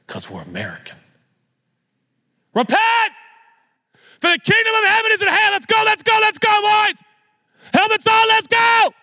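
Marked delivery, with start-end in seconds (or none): 2.5-8.9 high, loud coach bark at the team; football coach yelling. Retold or imitated. Pastor mocking a preacher who yells like a coach, shouting. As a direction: fire up the boys like a coach. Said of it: "like a high school football coach".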